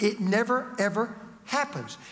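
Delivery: ordinary talk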